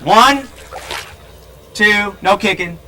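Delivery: loud, high call. Real, not acted. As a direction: call the count out over the water. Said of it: "I have to raise my voice so she can hear me".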